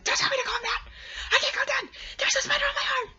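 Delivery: high, loud furious shriek; panicked yell. Retold or imitated. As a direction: scream back at mom to stop telling you to calm down. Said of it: "I was like".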